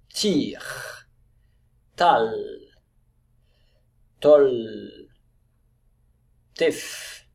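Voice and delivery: "Tir", "Tal", "Tol" one at a time, final consonant held; syllable reading. Shown as a demonstration. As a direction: read each syllable like "Tol" alone, drawing out its last sound. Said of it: "Allez, on lit ensemble".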